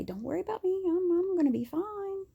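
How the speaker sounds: high, sing-song brush-off; forced cheerful reassurance. Retold or imitated. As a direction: insist you're fine with a bright false voice. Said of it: "blood squirting out of me".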